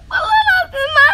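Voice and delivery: high, sobbing plea of innocence; crying denial. Acted. Accused schoolgirl sobbing to her teacher. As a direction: beg through tears that you did nothing.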